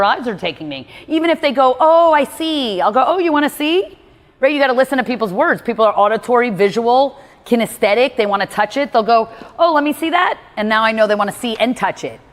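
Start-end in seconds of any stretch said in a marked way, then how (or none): 1.8-2.8 high, slow customer voice; imitating customer. Customer spotting something in the store, slow high imitation. Customer reacting during sale. 3.1-4.0 high, bright-pitched mirroring question; mirroring customer. Retold or imitated. Salesperson mirroring an eager customer, high echoing question. 9.6-10.4 high eager customer voice; imitating customer. Customer eager to hold the ring, high eager imitation. Customer wanting to touch item.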